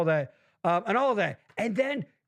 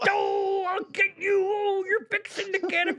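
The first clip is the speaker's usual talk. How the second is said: Bill Cosby impression; 11 semitones higher than usual. Mocking friend in a high Cosby voice. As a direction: yell it up high like a sputtering Cosby. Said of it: "that was getting dangerously close to Cosby".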